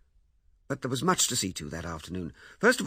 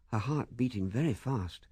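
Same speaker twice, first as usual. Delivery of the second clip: plain reading voice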